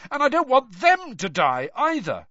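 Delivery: high, loud, urgent yell; yelled protest. A narrator's reading. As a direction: keep yelling, voice pitched right up. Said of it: "Harry yelled".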